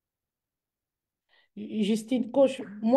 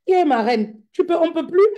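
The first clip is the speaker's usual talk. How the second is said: mock exasperated coach; 9 semitones higher, 8 dB louder than usual. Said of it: "Je dis".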